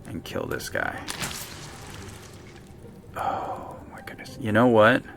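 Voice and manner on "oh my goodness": breathy whisper, defeated; whispered exasperation. Real, not acted. Gamer after a bad move, whispered exasperation. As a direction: sigh it out under your breath.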